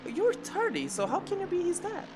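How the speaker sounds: ordinary delivery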